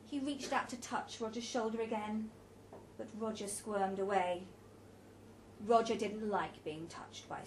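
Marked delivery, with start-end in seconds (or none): none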